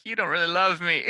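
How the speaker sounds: high, whiny complaint. Retold or imitated. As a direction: whine it like a hurt child. Whiny self-quote to wife.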